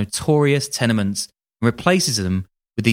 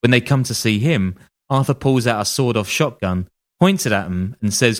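normal talking voice

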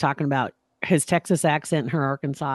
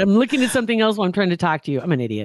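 ordinary delivery

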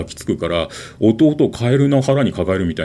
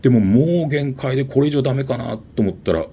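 ordinary talk

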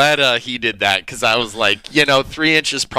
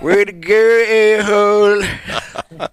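louder, dragged-out sarcastic cheer; mocking cheer; 7 semitones higher, 4 dB louder, much slower than usual. Real, not acted. Sarcastic slow cheer at the rude neighbor. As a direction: stretch out A-hole mockingly.

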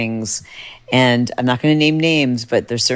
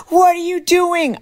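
much higher, slow shocked cry; alarmed disbelief; slower than usual. Retold or imitated. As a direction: cry out at her reckless friend. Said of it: "and everyone's like".

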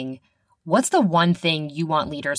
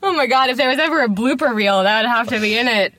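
fast, high, loud through laughter; amused, laughing; 6 semitones higher, 5 dB louder, faster than usual. Real, not acted. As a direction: gush it while still cracking up. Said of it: "I'm laughing so hard".

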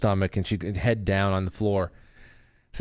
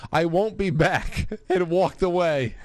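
laughing; 7 semitones higher, slower than usual. Retold or imitated. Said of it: "Instead, I said".

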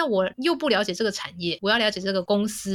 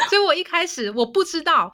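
ordinary talk, nothing marked

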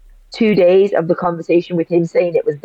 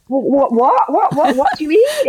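bewildered; 10 semitones higher than usual. Retold or imitated. Confused reply to shouting doctor.

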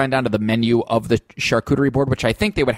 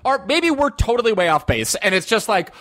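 rising excited pitch; getting carried away, higher; 8 semitones higher than usual. Real, not acted. Excited bird theory, pitch rising.

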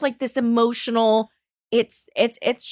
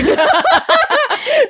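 laugh; 6 dB louder than usual. Real, not acted. Giggling at own craziness.

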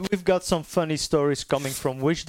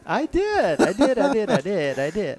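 high, gleeful repetition; sing-song teasing glee; excited; 6 semitones higher than usual. Real, not acted. Teasing about the present.

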